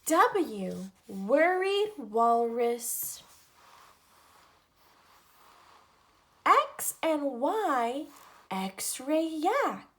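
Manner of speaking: lively picture-book read-aloud, each letter set apart; reading to children. Acted. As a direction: read an alphabet book to little kids. Reads alphabet book to children.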